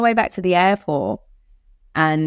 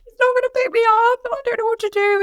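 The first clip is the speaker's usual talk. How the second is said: much higher, helpless mock-panicked voice; imitating panic. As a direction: replay the moment of feeling stranded, pitched way up. Panicked message to friend retold.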